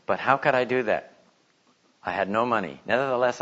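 ordinary talk, nothing marked

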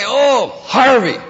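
high, loud acted exclamation; hammed-up, drawn-out curse; mock oath. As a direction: cry out the name like a swear word. Mock oath with a substitute name.

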